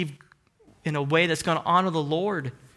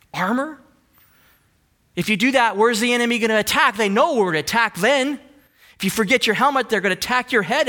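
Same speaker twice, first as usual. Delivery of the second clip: higher, louder, quick fiery preaching; rally the church, fast and loud; driving the point home with fire; preaching at full force; 5 semitones higher, 4 dB louder than usual. Acted. Preaching battle image.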